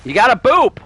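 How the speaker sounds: insistent. Real, not acted. Insisting on the boop.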